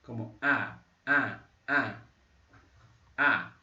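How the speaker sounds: ordinary talk, nothing marked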